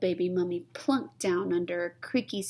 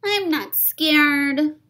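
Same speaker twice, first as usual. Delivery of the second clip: put-on slow, high, defiant child voice; stubborn high little kid; brave little child; 8 semitones higher, 7 dB louder, much slower than usual. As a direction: insist bravely, slowly.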